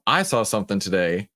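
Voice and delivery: ordinary delivery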